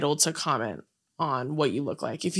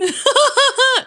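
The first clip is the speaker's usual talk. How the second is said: loud high burst of laughter; real loud laugh; 8 dB louder than usual. Real, not acted. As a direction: crack up at the callback. Laughs at callback joke.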